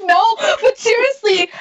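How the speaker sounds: shouting through laughter; laughing shout. Real, not acted. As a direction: blurt it out laughing hard.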